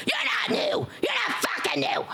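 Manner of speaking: high shrieking gremlin yell. Acted. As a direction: screech it like an angry gremlin. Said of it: "there's my gremlin voice".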